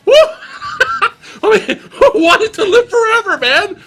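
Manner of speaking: a monk too happy to be upset by anything, cackling; giddy happy monk. Retold or imitated. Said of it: "the happiest monk in cinema".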